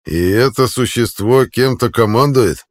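loud, slow contemptuous sneer. A narrator's reading. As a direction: sneer at him loudly. Centurion sneering at the sergeant.

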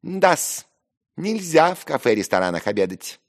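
high, loud, insolent jab. Acted. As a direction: chime in with brazen cheek. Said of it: "нагло прибавил Ферфичкин".

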